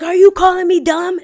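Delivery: high, loud outraged shout as the customer; mock flipping out. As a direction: explode in mock offence. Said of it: "man flips out".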